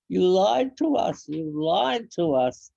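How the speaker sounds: loud, high accusing cry, falling at the end; a betrayed boy shouting at his mother; retold angry yelling. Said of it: "started yelling and crying".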